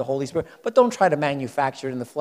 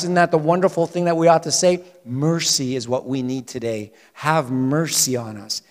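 usual speaking voice

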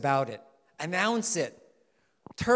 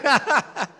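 laugh; 8 semitones higher, 11 dB louder than usual. Real, not acted. Laughs at the congregation's reply.

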